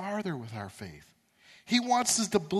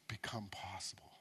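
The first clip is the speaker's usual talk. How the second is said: softer whispered ending; 10 semitones lower, 18 dB quieter than usual. Shown as a demonstration. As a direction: drop to a whisper for the promise. Sentence ends in whisper.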